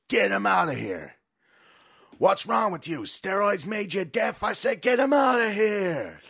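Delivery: slow, loud, high barked orders; angry shouting host. Retold or imitated. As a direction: yell at the lackeys to remove him. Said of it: "Damon Killian goes".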